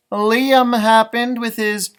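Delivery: plain reading voice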